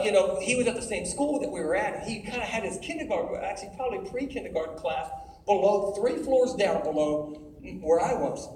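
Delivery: normal talking voice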